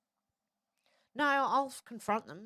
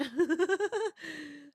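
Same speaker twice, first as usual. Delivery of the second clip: real laugh; 8 semitones higher, 4 dB louder than usual. Real, not acted. Laughs at a chat message.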